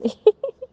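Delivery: bright amused laugh, pitched high. Real, not acted. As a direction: burst out laughing at the memory. Laughs at customer's insistence.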